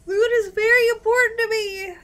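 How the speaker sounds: high, loud loot-greedy declaration; hammed-up shout. Real, not acted. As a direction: proclaim it like a cartoon miser.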